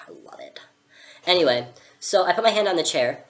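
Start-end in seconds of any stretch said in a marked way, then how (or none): none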